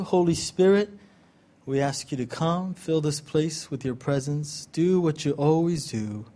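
earnest prayer. Acted. Guest pastor praying over the congregation before his sermon, softly reverent. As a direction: pray it gently, inviting the Spirit in.

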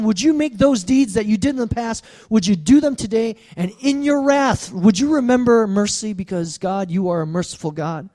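fervent plea. Acted. Preacher prays Habakkuk's plea fervently.

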